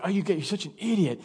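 quick, hushed mock self-reproach; mildly horrified, scold yourself under your breath. Pastor kicking himself after a slip in prayer, horrified and hushed. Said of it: "like, horrified".

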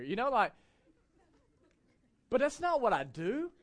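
ordinary talk, nothing marked

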